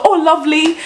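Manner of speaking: high, lilting mock endearments; imitating over-familiar stranger. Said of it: "someone would just come and go".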